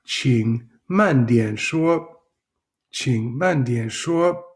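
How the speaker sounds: slowed way down, each syllable clear; slow, careful. Shown as a demonstration. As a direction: drag it out so learners catch every sound. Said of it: "Please speak slower".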